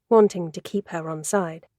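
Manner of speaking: ordinary delivery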